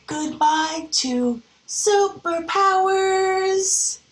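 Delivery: slow, high, drawn-out; sleepy bedtime line. Acted. Teacher reading the bedtime page, slow and high. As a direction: stretch it out as bedtime comes.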